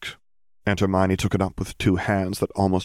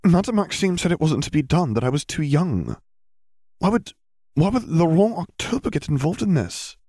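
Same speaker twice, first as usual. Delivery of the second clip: put-on higher, questioning girl voice; puzzled Hermione; 10 semitones higher than usual. Girl questioning her teacher, puzzled. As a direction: work it out aloud, confused.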